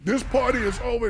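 loud, laughing reassurance; calm them, nervous laughter; nervous cop calming. Retold or imitated. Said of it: "And I was like".

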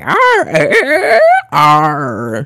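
drawn-out mock pirate "arr"; pirate dolphin growl. Pirate dolphins just going around, hammed-up pirate arrs. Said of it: "my rendition of a pirate".

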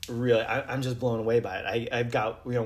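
usual speaking voice

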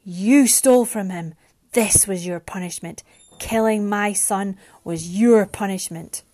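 loud, emphatic accusation; angry booming. A narrator's reading. Bereaved father tells Mariana her punishment, booming angrily. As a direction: hammer the blame home loudly. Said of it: "Brian's angry voice boomed".

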